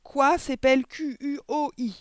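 high, loud spelled-out answer; full-throated shouting. A narrator's reading. Boy hanging by his ears answers, full-throated shout. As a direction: shout the whole answer, letter by letter. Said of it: "s'égosat-il".

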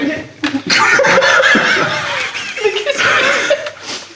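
long high fit of laughter; helpless laughter. Real, not acted. Reacting to the friend's absurd answer, long high laughing fit. Group loses it at joke.

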